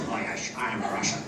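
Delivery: very low Scottish film-star voice; deep, slushy Scottish, no attempt at Russian; Sean Connery. Film star claiming to be Russian, deep Scottish impression. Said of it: "Then the next film he comes on".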